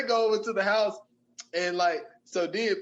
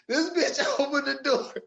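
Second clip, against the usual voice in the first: higher-pitched telling through laughter; laughing; 7 semitones higher than usual. Real, not acted. Storyteller cracking up at the surprise reveal, laughing. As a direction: laugh as you tell it.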